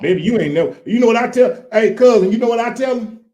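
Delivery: put-on loud, high, excitable country boy; country boy character. Guest hails a cousin as the country boy, in character.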